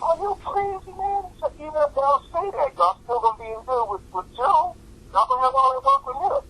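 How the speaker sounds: comic high-voiced impression; high mock voice. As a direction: exclaim it up high. Manager voiced emphatically.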